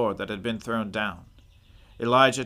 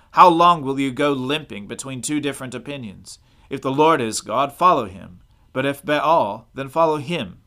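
louder, firm call to the crowd; commanding challenge; 2 semitones higher, 6 dB louder than usual. Acted. Elijah confronting all Israel at Carmel, raised commanding voice. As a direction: challenge the whole people, voice raised.

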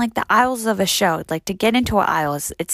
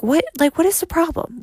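high-pitched, baffled demand; exasperated; 8 semitones higher than usual. Retold or imitated. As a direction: throw up an exasperated question, voice climbing high. Acted exasperation at mean women.

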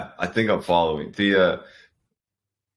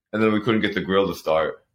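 ordinary talk

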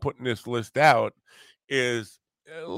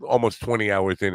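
usual speaking voice

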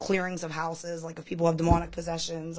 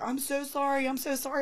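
imitated tearful apology; 7 semitones higher than usual. Reverend apologizing to the reader, imitated bawling. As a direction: retell a bawling man's apology, pitched up. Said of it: "he's bawling hysterically".

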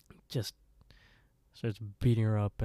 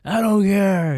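much higher, much louder screaming rage; furious yell; 11 semitones higher, 13 dB louder than usual. Retold or imitated. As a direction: scream it at her in a drunken fit. Drunk brother yells at sister.

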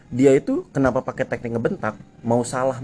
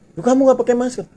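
much higher, louder mock argument; raising his voice; 13 semitones higher, 5 dB louder than usual. Rider argues back with the officer, voice raised high. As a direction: push back, pitch climbing higher. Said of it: "gue, gue tinggiin lagi".

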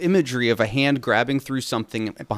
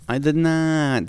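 very slow, higher hammed-up denial; Tommy Wiseau; 5 semitones higher, much slower than usual. Continuing the film actor imitation, slow and hammed up. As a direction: drag out the mock-dramatic protest. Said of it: "Tommy Wiseau".

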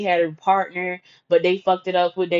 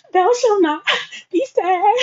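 mock solemn recital; 11 semitones higher, slower than usual. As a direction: intone it like a sacred law. Reciting next commandment.

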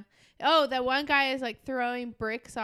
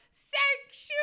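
high-pitched proclaiming cry; demonstrated yell; 12 semitones higher, slower than usual. Shown as a demonstration. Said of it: "and yell out".

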